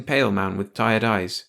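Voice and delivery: usual speaking voice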